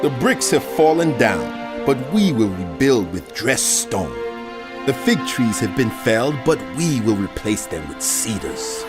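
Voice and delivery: boastful, defiant rebuilders; lofty, self-assured boast; proud and arrogant. Acted. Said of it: "who say with pride and arrogance of heart".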